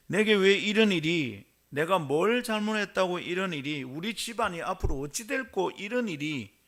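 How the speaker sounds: Joseph's lament. Acted. Joseph's lament voiced in sermon.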